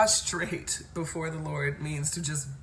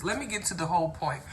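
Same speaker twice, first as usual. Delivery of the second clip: ordinary talk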